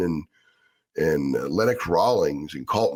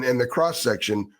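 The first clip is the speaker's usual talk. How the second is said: usual speaking voice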